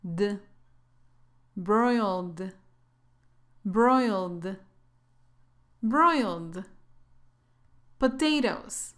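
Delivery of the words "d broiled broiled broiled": "D" as a bare consonant, then "Broiled" in one syllable; low, measured "Broiled", ending straight on d; silent e demo. Shown as a demonstration. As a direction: model "Broiled" slowly with no e before the d. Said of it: "La e no tiene sonido".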